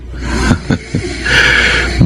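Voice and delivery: airy sinister laugh under the breath; breathy evil laugh. Acted. Intruder laughs over sleeping Vilma. (mahinang masamang tawa)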